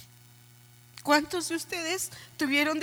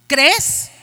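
¿Crees? fired out much louder, rising at the end; sharp loud question; 11 dB louder than usual. Real, not acted. Pastor challenging the family, loud rising ¿Crees?. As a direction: throw ¿Crees? back at the congregation as a loud challenge. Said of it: "¿Crees que por venir".

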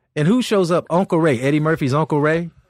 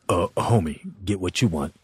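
lazy Paul voice; 12 semitones lower, 7 dB quieter than usual. Retold or imitated. Paul tells the comic to order anything, low and laid-back. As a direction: kick back like a big shot spending someone else's money. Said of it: "he's like".

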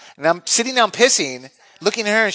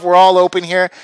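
ordinary delivery

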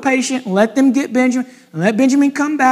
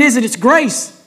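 ordinary talk

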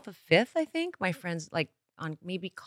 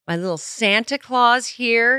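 normal talking voice

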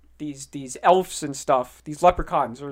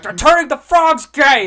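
slow, much louder, higher hammed-up shout; mock rant; 9 semitones higher, 12 dB louder than usual. Answering the phone to annoy Ian, hammed-up ranting shout. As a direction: bellow it like a conspiracy radio host.

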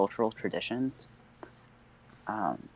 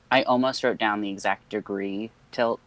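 ordinary talk, nothing marked

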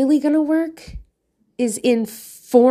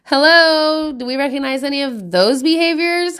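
normal talking voice

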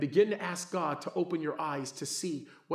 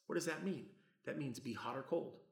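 ordinary talk

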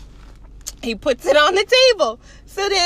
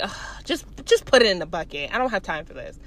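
low, softer weary guard; fed up; 7 semitones lower, 5 dB quieter, faster than usual. Retold or imitated. Security guard has no time for this, fed up. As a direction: sigh it out low, acting worn down.

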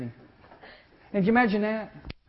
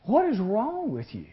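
1 incredulous, higher, slower; 6 semitones higher, slower than usual. Acted. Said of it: "Isn't that a weird response?".

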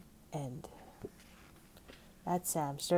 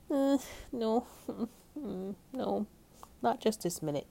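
reluctant, higher-pitched little refusal; hesitant refusal; 7 semitones higher than usual. A narrator's reading. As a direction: stall nervously, pitched up. Sam nervously stalls again.

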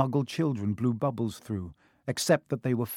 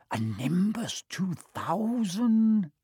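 slow, higher, drawn-out envious moan; 6 semitones higher, much slower than usual. Acted. Ron envying Harry's new broom, slow higher moan. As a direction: moan it like you'd kill to own one. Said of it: "Ron moaned enviously".

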